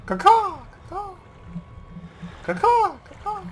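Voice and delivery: high shrill crow caws; shrill screeching bird woman. Pretend. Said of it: "I don't know why I'm a bird".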